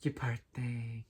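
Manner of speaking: lightly voiced soft, slowed pity from a passerby; mock sympathy. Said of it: "And then people say".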